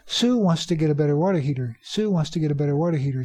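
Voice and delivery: fast, words run together; quick normal speech. Shown as a demonstration. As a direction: rattle it off with reduced sounds. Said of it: "Say it quickly in normal speech".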